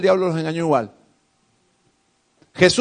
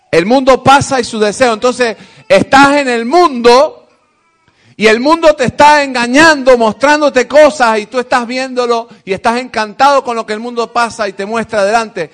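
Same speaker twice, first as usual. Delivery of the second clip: loud, emphatic preaching; fervent preaching; 4 semitones higher, 7 dB louder than usual. Acted. Preacher warning the church about the world, loud and fervent. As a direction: drive the warning home.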